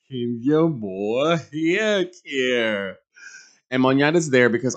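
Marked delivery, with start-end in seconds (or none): 0.1-3.0 very slow, each word of "Kenya Moore Hair Care" stretched; a hammed-up repeat of the ad slogan; slower mock ad tagline. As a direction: drag out "Hair Care" like a glossy jingle. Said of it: "filming some promotional commercial".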